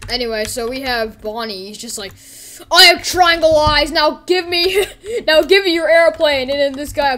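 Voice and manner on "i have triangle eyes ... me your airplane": high, loud, slow declaration; shouted toy-villain demand. Acted. Bonnie demanding the airplane, loud demanding shout.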